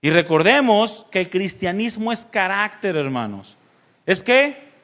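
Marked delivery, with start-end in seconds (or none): 4.1-4.7 high, loud prompt to the crowd; calling for the answer. Real, not acted. Pastor urges the congregation to answer aloud, calling out. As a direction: shout the question up at the room.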